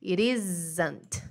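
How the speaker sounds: "It isn't" with a tapped R sound for the T; relaxed American flap; flapped T. Shown as a demonstration. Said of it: "Fica mais ou menos assim".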